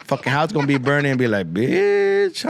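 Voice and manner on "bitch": high-pitched, drawn-out indignant cry; comic high-pitched outburst; mock indignant. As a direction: play the offended smoke detector. Burning house talks back in anger.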